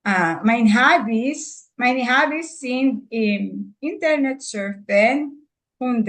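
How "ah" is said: ordinary delivery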